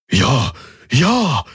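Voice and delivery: high, happy outcry; joyful exclamation. A narrator's reading. Crewman behind Galen cheering the news, joyful. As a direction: cheer it in delight. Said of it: "freudig ausrief".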